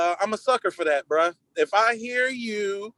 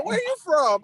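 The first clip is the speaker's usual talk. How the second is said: much higher, eager question; delighted question; 10 semitones higher than usual. Retold or imitated. To a Southern-sounding caller, high eager question. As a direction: perk right up. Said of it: "Yeah, I always go".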